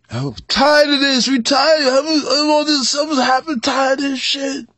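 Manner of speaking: high, strained voice of a fed-up people; weary complaint. Retold or imitated. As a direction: cry out high, worn down and fed up. Voicing oppressed people's complaint.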